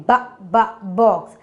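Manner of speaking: "Ba, ba" as short separate bursts, then whole "box"; sounding out. Shown as a demonstration. Teacher helps children hear B, sounding out. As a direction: isolate the first sound of "box" twice, then say it. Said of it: "Which sound you're hearing?".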